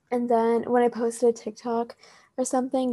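ordinary talk

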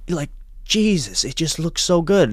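high-pitched awed British coworker; British accent. Retold or imitated. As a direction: marvel in a put-on British accent. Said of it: "give him my British accent".

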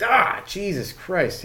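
high mock yelp, falling into a curse; startled, high-pitched retold exclamation; pained yelp. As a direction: act out a sudden sting in the ear. Acting out being hit by cigarette.

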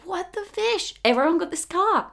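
high-pitched mock disbelief; astonished. New car buyer spotting it everywhere, high astonished squeal. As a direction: squeak it in amazement.

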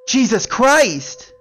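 wife's shrieking wake-up yell, very high and loud; jolted awake by a scream; shout. Acted. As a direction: scream it in shock and drag it out slowly. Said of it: "my wife shouted".

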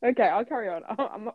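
normal talking voice

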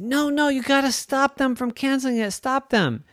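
high squeaky pleading; Roy urging the iguana, high mouse voice; urgent mouse. Acted. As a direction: push him to act, squeaky and urgent. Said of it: "And Roy said".

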